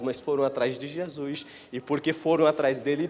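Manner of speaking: ordinary talk, nothing marked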